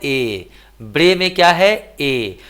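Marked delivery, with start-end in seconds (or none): none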